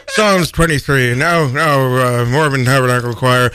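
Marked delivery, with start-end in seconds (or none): none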